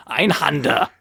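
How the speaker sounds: mock German pronunciation of Einhander; German accent. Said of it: "German people around me going".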